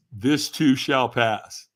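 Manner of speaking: slow, measured wise words; slow quote. Retold or imitated. Tom Hanks passing on advice, slow and measured. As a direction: let each word land slowly. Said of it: "and he goes".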